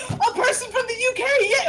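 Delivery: high-pitched mock-excited cheer; excited character reaction. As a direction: act out giddy delight, high and loud. Character's delighted reaction to accent.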